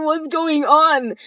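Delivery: high, loud, drawn-out question; exasperated retold demand. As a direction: demand answers, acting out the argument. Retelling her demand during argument.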